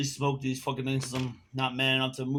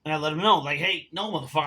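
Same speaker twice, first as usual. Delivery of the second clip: normal talking voice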